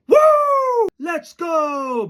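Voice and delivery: high, drawn-out whoop and cheer; mock high-pitched hollering; whooping opponents. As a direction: imitate a rival team whooping it up. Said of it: "a team that liked to whoop".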